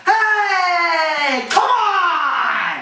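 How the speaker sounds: high, long-drawn sideline holler; protesting coach. Retold or imitated. Coach freaking out at the officiating, hollering in protest. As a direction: imitate a coach yelling at the officials. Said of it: "Here's my coach impressions".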